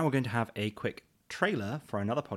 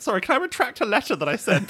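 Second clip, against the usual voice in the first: high, loud plea through laughter; mock-frantic plea; 13 semitones higher, 7 dB louder than usual. Sender begging at the boat to retract a letter, mock-frantic. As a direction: call out a flustered, laughing request.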